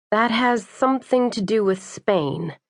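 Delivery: usual speaking voice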